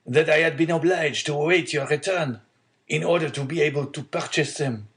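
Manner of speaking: a hint of an old man reasoning kindly, pitch lifted; prudent old father. Old father accepting son's purse, lightly voiced caution.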